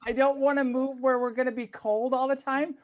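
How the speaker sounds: loud, high-pitched outburst; exasperated protest. Real, not acted. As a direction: protest loudly in disbelief. Exasperated protest about moving somewhere cold.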